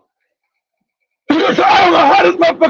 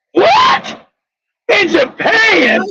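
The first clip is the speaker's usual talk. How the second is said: high-pitched yelped "what", then a drawn-out question; shocked disbelief; 5 semitones higher, much slower than usual. Retold or imitated. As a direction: act out stunned incredulity, stretching the words slowly. Retells shocked reply to accusation.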